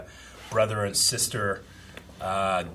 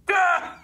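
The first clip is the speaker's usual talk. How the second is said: very high, loud burst of "ah"; sudden comic yelp of motion; imitated launch noise; 5 dB louder than usual. Showing how the ship moved, sudden high "ah". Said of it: "Did they just go like".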